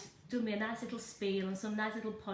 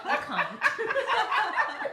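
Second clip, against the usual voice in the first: giggling, higher and louder; laughing refusal; 11 semitones higher, 9 dB louder than usual. Real, not acted. Refusing to do her accent.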